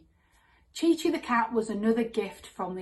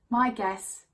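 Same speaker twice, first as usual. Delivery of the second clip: ordinary talk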